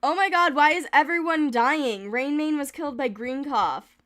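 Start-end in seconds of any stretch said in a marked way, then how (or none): 0.0-2.1 shocked outcry. Real, not acted. Shocked by cats dying.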